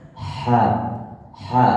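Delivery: ha voiced as a breathy throat sound; fathah ha. Shown as a demonstration. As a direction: say ha from the throat with an open a.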